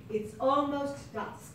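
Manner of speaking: slow British accent, sound at the front; British accent demo. Shown as a demonstration. Director models the line for the actor in a slow British accent. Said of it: "Repeat after me".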